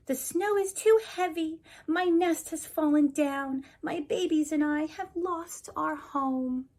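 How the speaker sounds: high, fretful bird voice; worried little bird. Acted. Bird character tells her trouble.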